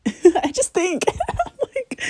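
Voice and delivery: high, drawn-out words breaking into laughter; laughing. Real, not acted. Vlogger questioning her own behaviour, breaking up laughing. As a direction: crack up at yourself mid-thought.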